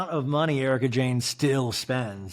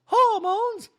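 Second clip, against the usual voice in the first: very high questioning squeal; 5 dB louder than usual. Retold or imitated. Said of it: "And Dorit goes".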